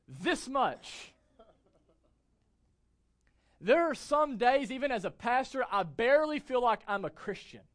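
loud, rising preacher's build; fervent sermon delivery; full-force preaching. Real, not acted. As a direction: preach it out to the whole room.

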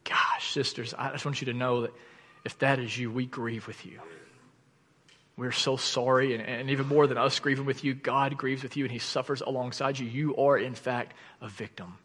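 soft, hushed sympathy, sinking at the end; tender compassion. Real, not acted. Pastor consoling abused women in his church, softly compassionate. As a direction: grieve gently with the listener. Grieving with abuse victims in congregation.

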